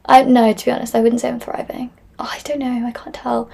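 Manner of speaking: ordinary talk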